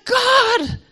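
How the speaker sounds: high-pitched cry to God; retold urgent plea. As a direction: plead upward for healing. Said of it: "And I'm going".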